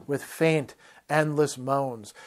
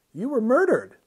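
lightly tossed off, high; 9 semitones higher than usual. A narrator's reading. Brother tells Paran of his murder, airily light. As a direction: say it airily, like small news. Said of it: "the man said lightly".

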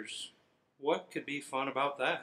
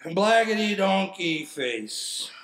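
slow, louder goofy voice; silly voice; 4 semitones higher, 6 dB louder, slower than usual. Acted. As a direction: drag out the goofy insult. Final silly line.

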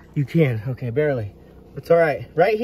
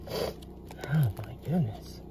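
slow breathless whisper; hushed wonder; awed whisper; 6 dB quieter, much slower than usual. Real, not acted. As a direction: whisper it as if afraid to disturb the rock.

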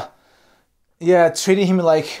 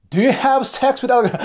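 pitched-up, blunt question, imitating the girl; mock interrogating girlfriend; 9 semitones higher, 3 dB louder than usual. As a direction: act out a pushy date grilling him. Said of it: "Like this, you know?".

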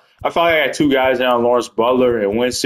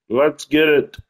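ordinary talk

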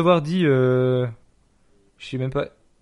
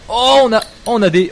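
high, loud burst of discovery; excited surprise; 6 semitones higher, 6 dB louder than usual. Real, not acted. Streamer spotting flames in the game, excited surprise. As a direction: burst out as you spot something.

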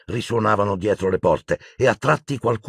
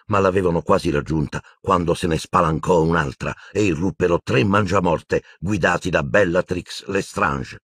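ordinary delivery

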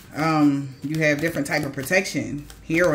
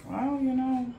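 soft and quiet; 11 dB quieter than usual. Real, not acted. Soft closing reflection.

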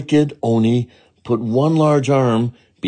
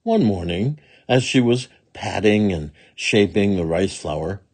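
usual speaking voice

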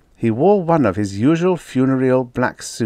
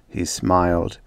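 usual speaking voice

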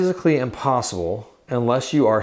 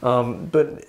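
normal talking voice

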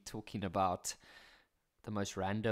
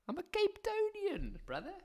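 Cape Town voice. Retold or imitated. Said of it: "Some of you are like".

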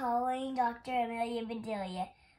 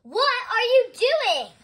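high, slow, drawn-out yell; loud outraged demand, each word stretched; 12 semitones higher, 14 dB louder, much slower than usual. A narrator's reading. Mister Rogers catches Amelia Bedelia frying an egg on his car, yelling. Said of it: "yelled Mister Rogers".

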